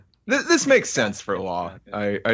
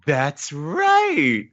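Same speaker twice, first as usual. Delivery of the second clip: higher, put-on teacher voice; woman teacher; 6 semitones higher, slower than usual. Teacher prompting a class of kids, high teacherly voice. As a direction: praise the pupil, then quiz the class. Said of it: "we hear a woman saying".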